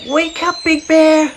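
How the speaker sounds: a tiny eager bear cub pleading; little bear voice. A narrator's reading. Little Bear's voice in story.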